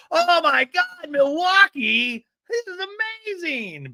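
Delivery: very high, slow, stretched squeal; mock awe. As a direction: gush in exaggerated high-pitched amazement. Mimics ladies wowed by Milwaukee.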